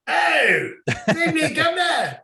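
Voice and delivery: high, drawn-out bad Australian shout; attempted Australian accent. Host clowning for co-host, hammed-up Australian accent. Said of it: "trying to go for an Australian accent".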